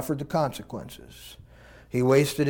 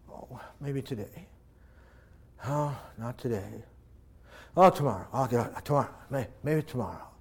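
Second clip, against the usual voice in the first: soft, wistful father sighing to himself; a father quietly hoping his son comes home; hopeful longing; 2 semitones lower, 6 dB quieter than usual. Acted. The father scanning the horizon for his son, soft and wistful.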